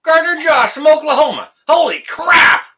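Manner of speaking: high, loud cheering; thrilled shout. Real, not acted. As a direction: yell it out in delight. Recognizes caller, cheering.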